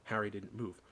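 usual speaking voice

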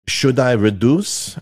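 put-on slow question in rough French accent; mock French schoolboy, drawn out. French student asking his teacher about a fraction, rough French accent. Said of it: "Sorry about the French accent there".

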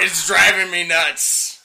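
loud, slow pirate punchline. Retold or imitated. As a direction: belt out the pirate's groan. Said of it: "And the pirate goes".

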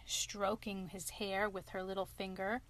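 plain reading voice

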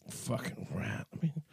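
breathy under-the-breath whisper; a disgusted whispered aside. Real, not acted. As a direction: mutter it to yourself in a whisper. Muttered aside to himself.

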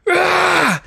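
high straining "aah" cry; all-out shove grunt-yell; hammed-up exertion cry; mock effort yell. Acting out pushing as hard as possible.

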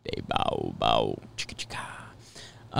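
low whispered sound effects; whispering. Real, not acted. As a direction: breathe it under your breath.

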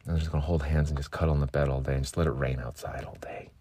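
soft whispered daydream; dreamy whisper. Retold or imitated. Lovesick self whispering cuddle plans to a partner. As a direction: whisper it like a lullaby. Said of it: "That's me thirty minutes after waking up".

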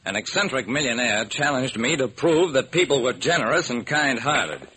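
usual speaking voice

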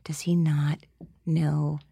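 hushed shocked whisper. Retold or imitated. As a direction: whisper it in alarm. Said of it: "And I was like".

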